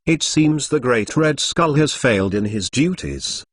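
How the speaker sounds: plain reading voice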